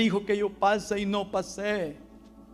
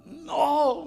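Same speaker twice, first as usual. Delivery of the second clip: put-on much higher, louder child's tearful no; crying boy imitated; 11 semitones higher, 7 dB louder than usual. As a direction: answer like a weeping boy. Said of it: "Y seguía llorando". (niño que llora dice no, imitado)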